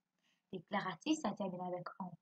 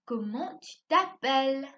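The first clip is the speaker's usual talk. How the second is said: higher, louder, slow asking voice; question tone; 4 semitones higher, 7 dB louder than usual. Shown as a demonstration. Teacher modelling a question to pupils, louder and higher. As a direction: lift "Comment tu t'appelles" as a clear question. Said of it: "quand on va poser une question, on va dire".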